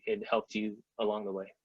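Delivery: ordinary talk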